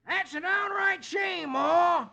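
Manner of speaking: high, slow hillbilly exclaim; horrified hillbilly. Acted. Snuffy reacts to Louisy, high horrified hillbilly cry. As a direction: gasp it out in horror. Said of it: "exclaims in horror".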